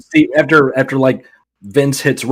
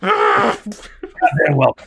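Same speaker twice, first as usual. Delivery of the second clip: mock drawn-out welcome; much slower than usual. Drawn-out cartoon line.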